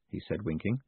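ordinary delivery

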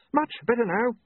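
urgent high-pitched exclamation; mild shout, voice shooting up. A narrator's reading. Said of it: "he shouted".

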